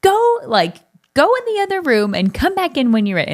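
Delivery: mother angrily ordering her daughter to leave the room; harsh order. Retold or imitated. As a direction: send her out of the room, hot and sharp. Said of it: "I was pretty harsh with how I said it".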